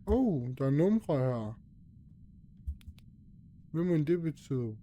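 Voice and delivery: ordinary talk, nothing marked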